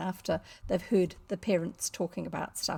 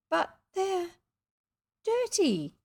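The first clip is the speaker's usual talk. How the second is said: put-on very high, hesitant child; doubtful little girl; 10 semitones higher, slower than usual. Girl refusing dirty sweets from boy, high-pitched. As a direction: object in a girlish squeak.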